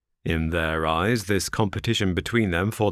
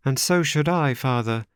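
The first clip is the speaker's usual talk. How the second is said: higher, spirited reply; 7 semitones higher than usual. Acted. Lucy to her father, higher and spirited. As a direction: answer boldly. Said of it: "she answered with spirit".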